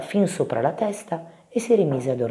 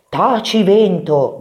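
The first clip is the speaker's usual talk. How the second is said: loud, raised order to be silent; stern command; 6 semitones higher, 7 dB louder, slower than usual. A narrator's reading. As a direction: scold the wind. Big mouse silences the wind. (comando forte e severo)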